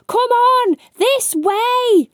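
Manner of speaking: high, loud panicked shout; screaming. Acted. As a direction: yell to the goblin to run. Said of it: "while screaming to the goblin".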